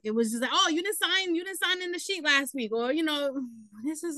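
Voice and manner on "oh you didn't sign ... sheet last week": lightly mocked officious guard, pitched up; jerk guard. Resident imitates security guard over a sign-in sheet, mock nagging. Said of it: "they just wanted to be a jerk".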